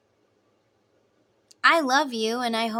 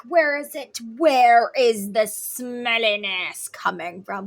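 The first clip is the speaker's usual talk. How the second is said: loud, hammed-up indignant search; mock alarm; 8 dB louder, slower than usual. Looking around for the stink, loud mock indignation. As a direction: stretch out the words in overdone horror.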